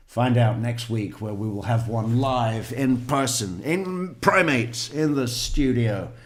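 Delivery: big, loud, hyped-up TV promo voice; mock show announcer. Host teasing next week's live primate show, mock announcer. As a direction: punch out a mock next-week teaser.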